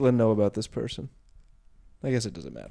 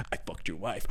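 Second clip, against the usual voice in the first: lower, softer, smug dude; low quiet dude voice; 3 semitones lower, 11 dB quieter than usual. Retold or imitated. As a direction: mutter "I fucked your wife." like a smug swinger. Two swinging dudes acted out.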